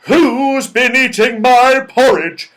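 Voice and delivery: a big bear cross about his porridge; angry father bear. A narrator's reading. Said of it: "said the father bear angrily".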